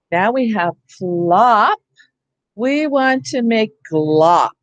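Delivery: slowly, each word spaced; slow teacher modeling. Shown as a demonstration. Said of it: "teacher modeling sound change".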